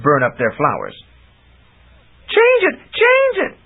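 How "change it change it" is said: high, loud frantic plea; panicked cry. Acted. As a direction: beg in terror as the dragon turns. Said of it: "Chameleon cried".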